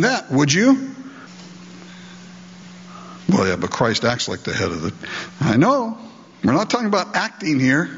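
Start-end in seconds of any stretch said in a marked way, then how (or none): 3.3-4.9 a mild, muttered objection in a lower voice; doubting objection. Retold or imitated. Preacher voicing a wife arguing back, low and grumbling. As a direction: grumble the "yeah, but" back at him.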